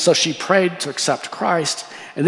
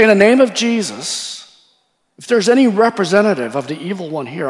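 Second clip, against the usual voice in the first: raised, louder voice addressing evil spirits; preacher's commanding call; acted exorcism command; 5 semitones higher, 4 dB louder than usual. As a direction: re-enact a formal command to demons. Acts out commanding demons to appear.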